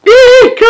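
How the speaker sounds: a sudden high squeal of fright; frightened shriek. A narrator's reading. George jumps from his chair at the snake, shrieking. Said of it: "cried George".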